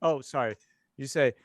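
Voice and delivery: normal talking voice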